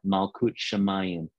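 "Malkut Shamayim" said slowly, syllable by syllable; slow Hebrew pronunciation. Shown as a demonstration. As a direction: sound out "Malkut Shamayim" for others to repeat. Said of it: "I'm gonna ask you just to practice saying that".

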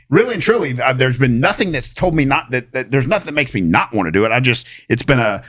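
normal talking voice

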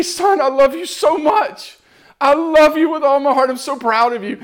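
high, heartfelt declaration of love; impassioned fatherly love. Retold or imitated. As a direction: pour out a father's pride, pitch lifted. Voicing God's fatherly love and pride.

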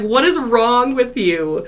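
loud, high, drawn-out scolding question; acted-out incredulous reproach; retold exasperation. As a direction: stretch it out, fed up with a friend. Friends' exasperation voiced.

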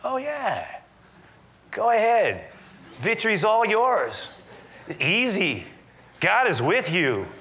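high, mock-cheering false encouragement; hammed-up sarcasm, each short phrase sung out as fake enthusiasm; sarcastic. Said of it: "Micaiah replied sarcastically".

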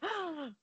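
sharp, soft mock gasp; sudden gasp of mock shock; dramatic gasp. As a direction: imitate a shocked castmate's gasp. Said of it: "The gasp".

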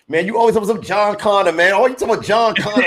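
lightly imitating the folks around him, high and teasing; people teasing him. Friends teasing him about his favorite rapper, in a high mock voice. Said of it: "they say".

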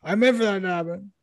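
high-pitched, boastful fighter character voice; Stipe. Acted. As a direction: play a defensive champ insisting on his greatness. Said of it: "Thank you, Stipe".

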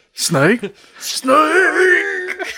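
questioning, then a long high cry; mock game-over cry. Quoting Metal Gear to the co-host, anguished mock cry. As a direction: draw the name out into a high mock scream.

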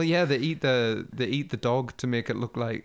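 ordinary talk, nothing marked

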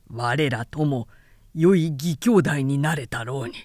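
a low, pleased warrior's voice; a touch of gruff approval; lightly voiced Guan Yu. A narrator's reading. Guan Yu laughing remarks.

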